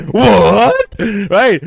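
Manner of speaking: loud, high What in disbelief; shocked. Retold or imitated. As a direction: yell it in amazement. Repeating shocked reaction.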